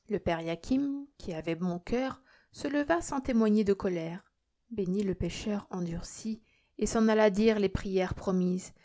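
ordinary delivery, nothing marked

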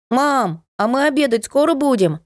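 put-on high child's call from another room. A narrator's reading. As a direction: call out like a hungry kid. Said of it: "детский крик". (детский крик из комнаты)

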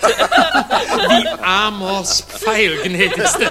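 slow laughing gallantry; amused flirting with a laugh. Acted. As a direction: chuckle the compliment.

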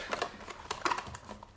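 a breathy little chuckle of satisfaction; quiet gloating laugh under the breath; a hushed, pleased snicker; soft sly snicker. Acted. Villain alone staging suicide.

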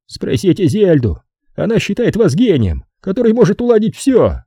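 high-pitched, put-upon man; high, testy character voice; irritated Brogan. Acted.

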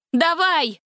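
high, snapped order; commanding cry; sharp command. A narrator's reading. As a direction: give the signal now. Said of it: "скомандовала Гретель".

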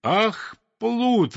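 high, drawn-out cries of outrage; scandalised high wail; indignant outcry. A narrator's reading. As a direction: exclaim as a crowd cheated by a thief. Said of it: "возмутились все рыбы и все птицы".

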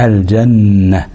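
الجنة slow, with a long nasal ن; held ghunna. Shown as a demonstration. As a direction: dwell on the doubled ن of الجنة. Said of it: "ننطق الميم المشددة". (الجنة بغنة ممدودة على النون)

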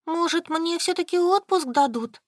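high, pleading whine; whining. A narrator's reading. Solka hopes aloud for leave, whining. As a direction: whine it, pitched well up. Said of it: "заныла Солька".

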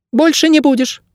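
very high, thin creature voice; squeaky bird guide. A narrator's reading. Bird guide promising his chained ward freedom, high squeak. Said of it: "сказал Птах".

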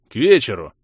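loud, weighty hint; meaningful. A narrator's reading. Vlas hinting at the evening's party, meaningfully. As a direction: say it with knowing significance. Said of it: "многозначительно произнёс Влас".

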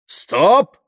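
loud, high, rising cry; shouted halt. A narrator's reading. Tamara stopping the threatening crowd, shouted halt. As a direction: cut the crowd off with one shout.

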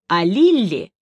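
ordinary delivery, nothing marked

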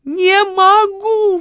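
long, high, loud howl; wailing. A narrator's reading. Lola balks at the storeroom, howling in panic. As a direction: wail it out, stretching the vowels. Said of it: "взвыла Лола".

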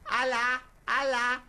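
put-on squeaky tiny-girl voice; high little fairy voice. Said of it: "Es como una hadita".

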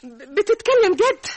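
can't believe her luck, rising with joy; excited disbelief. Acted. Wife asks husband if he means it, excitedly.

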